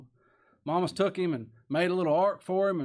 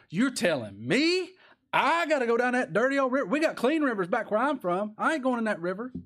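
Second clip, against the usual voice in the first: high, loud, scornful complaint; indignant refusal; 8 semitones higher, 4 dB louder than usual. Retold or imitated. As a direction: sneer at the dirty river like a proud general. Naaman voiced refusing dirty river.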